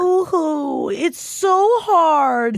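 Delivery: high, slow mock whine; sarcastic mock pity.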